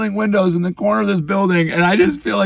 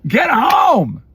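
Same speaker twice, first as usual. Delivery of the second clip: higher, raised exasperated voice; fuming shout; 5 semitones higher than usual. Real, not acted. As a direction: let it boil over. Dad fuming over son.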